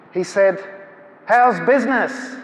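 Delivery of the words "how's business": higher, drawn-out imposing man; slow, showy call to the whole room; booming mock greeting. Imposing visitor addressing the bookstall for attention, showy mock greeting.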